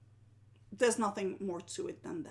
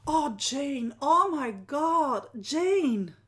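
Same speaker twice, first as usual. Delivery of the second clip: loud, raised, drawn-out amazement; touched exclamation; 4 semitones higher, 9 dB louder, much slower than usual. Real, not acted. Host reading Jane's comment, touched and amazed. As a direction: exclaim the name, slow and overcome.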